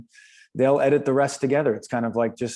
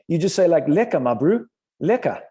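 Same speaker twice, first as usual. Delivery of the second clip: a light imitation of a South African accent, slightly higher; Carl's South African accent, lightly mimicked; 4 semitones higher than usual. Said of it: "The accent".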